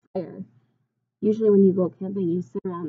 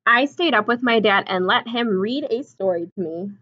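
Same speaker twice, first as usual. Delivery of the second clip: storybook reading; 4 dB louder than usual. A narrator's reading. Reads picture book aloud.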